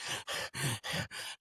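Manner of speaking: quiet, breathy imitation of winded puffing; stifled panting. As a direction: puff softly as if hiding breathlessness. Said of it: "trying to hide it from people that I'm out of breath".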